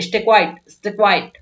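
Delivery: a touch of anger, demonstrated; mock-annoyed command to hush; slightly angry. Shown as a demonstration. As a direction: say it as a mildly cross order. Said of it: "थोड़ा गुस्से में बोलते हैं, तो ऐसे बोलोगे".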